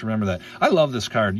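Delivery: ordinary talk, nothing marked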